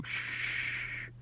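long, very soft shh; gentle drawn-out hushing sound; breathy shush to a child. Acted. Father hushing panicking boy.

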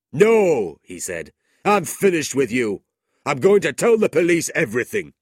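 high, loud, fast at the end; angry refusal. A narrator's reading. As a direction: cut him off and refuse. Squeers refusing Ralph.

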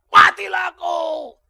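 slow, loud, high shout of a threat; acted shouted threat. Jailer's cry in Paul's prison story, slow loud shout. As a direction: drag out a menacing shout.

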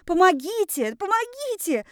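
high-pitched cries for help; shrieking. A narrator's reading. Heroine stuck in a box begs for help, shrieking. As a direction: squeal for rescue in acted panic. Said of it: "заверещала я".

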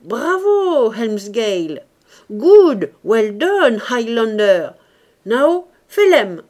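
loud, high English cheers; ringside cheering. A narrator's reading. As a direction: call out encouragement to the fighters. (acclamations lancées à pleine voix)

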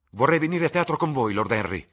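a bright eager youth; eager young Dorian. Acted. (giovane entusiasta, voce molto più alta e forte)

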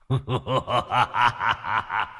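put-on slow, rolling old-sage chuckle; fairy-godfather Bụt laugh. Bụt popping up before a crying child, rolling sage laugh. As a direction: laugh grandly like the kindly Bụt. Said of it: "làm ông bụt".

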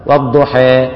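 "والضحى" with the final vowel tilted gently toward e, not i; measured correct imala of "والضحى"; moderate imala. Shown as a demonstration. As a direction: lean "والضحى" slightly toward e. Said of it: "هي طريقة معينة".

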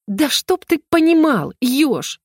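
slow, high-pitched indignant outburst; sudden flare-up. A narrator's reading. Girl snapping back at the nosy hedgehog, flaring up. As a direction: flare up, dragging the words out high. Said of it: "Внезапно взвилась, как пружина, Лайза".

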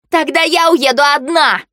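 heated, high-pitched outburst; angry defiance. A narrator's reading. Marsha threatens to leave alone.

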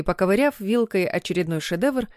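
ordinary delivery

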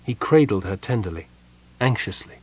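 usual speaking voice

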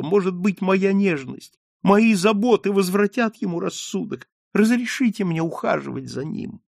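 high, tender, pleading voice; tearful pleading. A narrator's reading. Margarita asking to nurse her father, tearful. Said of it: "Маргарита всхлипнула".

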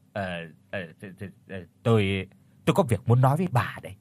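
stuttering, then blurting the rest fast; hesitant stammer. A narrator's reading. Nervous husband working up to telling his wife, stammering then hurried. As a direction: trip over the start, then rush it out. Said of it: "nói cái giọng ngập ngừng".

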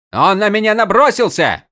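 high, whiny protest; indignant protest. A narrator's reading. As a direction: protest like a stung brat. Prince protests defensively.

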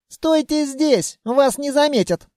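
put-on high, lightly amused child's voice. A narrator's reading. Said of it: "усмехнулся голос". (с лёгкой усмешкой, высоким голосом)

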